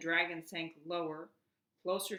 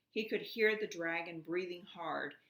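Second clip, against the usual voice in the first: usual speaking voice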